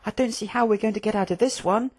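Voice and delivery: high, small, worried voice; timid Hectus. Acted. Hectus despairing to Quilk in the cell, timid.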